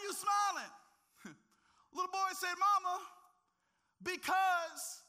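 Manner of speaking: loud, high preacher's cry; preacher at full force to the congregation; full-force preaching. Acted. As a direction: shout the story's climax to the church. Climax of sermon story, shouting.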